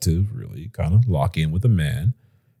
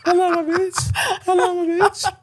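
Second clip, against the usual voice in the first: squeaky mock greeting; 4 dB quieter than usual. Teasing greeting voice.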